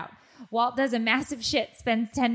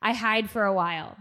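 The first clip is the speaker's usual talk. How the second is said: usual speaking voice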